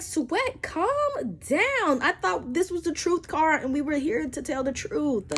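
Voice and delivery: high, sarcastic calm-down voice; mock soothing. Mock calming the boyfriend.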